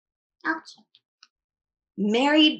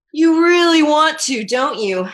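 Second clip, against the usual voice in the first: loud; 3 semitones higher, 8 dB louder than usual. Acted. Mom voiced in the story.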